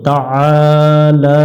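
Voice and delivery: slow তাআলা, ain pressed; stretched তাআলা from the throat; Arabic pronunciation. Shown as a demonstration. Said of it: "আইন অক্ষরটা চেপে পড়ব".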